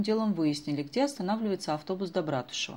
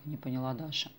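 usual speaking voice

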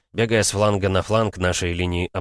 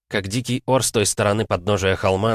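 ordinary delivery, nothing marked

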